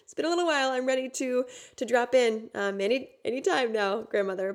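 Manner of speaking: high, fast, impatient plea to be let in; impatient frustration. Retold or imitated. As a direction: hurry her along, fed up with waiting. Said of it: "I'm getting kind of a little frustrated".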